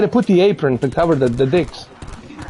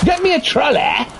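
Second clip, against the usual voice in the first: imperious plummy grande dame; 8 semitones higher than usual. Pretend. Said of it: "I need to create an accent".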